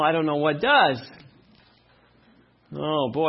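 normal talking voice

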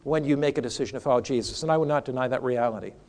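low, soft, earnest counsel; reassuring personal appeal. Acted. Pastor encourages John to follow Jesus, soft and reassuring. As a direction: gently assure him, voice dropped and intimate. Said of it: "so what do I say to him?".

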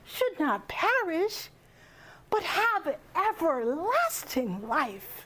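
slow, sweeping emphasis with swooping pitch; expressive delivery. Shown as a demonstration. Speaker showing an audience that delivery matters, expressive recital. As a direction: stretch the key words and let the pitch soar.

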